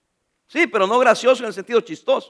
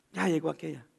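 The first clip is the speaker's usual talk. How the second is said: grudging mutter; 7 semitones lower, 14 dB quieter than usual. Acted. Mock mutter about an arrival.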